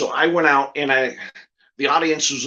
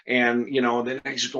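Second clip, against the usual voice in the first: normal talking voice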